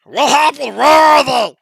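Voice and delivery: high loud alarmed creature shout; lizard folk shout. Acted. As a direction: yell out like a startled lizard guard. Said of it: "and you hear".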